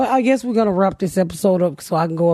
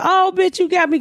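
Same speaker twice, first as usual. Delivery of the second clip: surprised mock squeal; 10 semitones higher than usual. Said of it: "She said".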